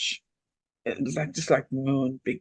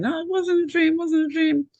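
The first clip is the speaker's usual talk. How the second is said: high, insistent imitation of a scared child; child's protest. Mother retelling her frightened daughter's protest, high insistent child voice. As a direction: mimic an upset child insisting, pitched right up.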